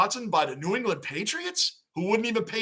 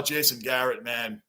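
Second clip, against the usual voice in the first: ordinary talk, nothing marked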